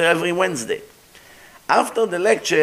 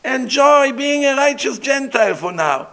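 dismissive quote; 8 semitones higher than usual. Retold or imitated. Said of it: "I said".

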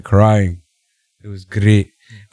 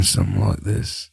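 slowed Regal voice; 2 semitones lower, 9 dB quieter than usual. Retold or imitated. Host lightly mimics Regal slowing down. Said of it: "He slows it down".

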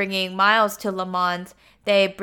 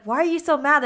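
higher, lightly exasperated acted question; mock dismay, raised pitch; bewildered protest; 6 semitones higher than usual. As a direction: imitate a husband baffled by anger. Husband protests in acted quarrel.

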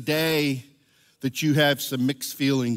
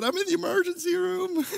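sheepish admission. Retold or imitated. Husband sheepishly confessing to wife on the phone. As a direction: confess it like a guilty kid. Admitting to the ER.

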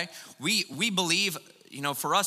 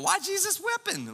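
mock confused question. Puzzled reader mock question.

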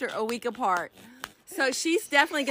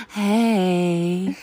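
sexy voice; 3 semitones lower than usual. Pretend. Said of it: "That was [name]'s sexy voice".

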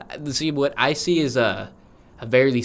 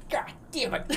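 higher, soft hammed-up doctor; mock doctor curse; 13 semitones higher, 10 dB quieter than usual. Doctor voiced by a friend, lightly hammed up. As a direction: play the doctor cursing.

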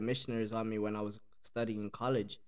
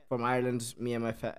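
ordinary delivery

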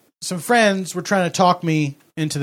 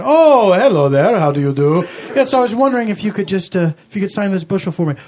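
a hint of a Dracula accent; bad vampire-ish accent. Fan greets Mister Guerrero, light put-on accent. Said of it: "what's up with your accent".

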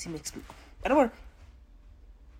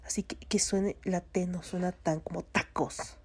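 ordinary talk, nothing marked

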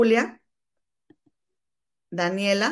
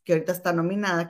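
ordinary talk

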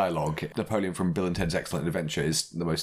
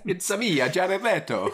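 much higher, louder hammed-up fake Italian accent as [name]; mock [name] Italian accent; 13 semitones higher, 3 dB louder, slower than usual. Podcaster mocks [name]'s Gucci role, hammed-up fake Italian accent. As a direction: lampoon his dubbed-movie Italian accent. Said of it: "accent that was made up by non-Italians for dubbed movies that [name] thinks is a real accent".

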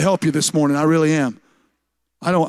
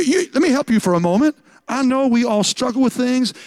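usual speaking voice

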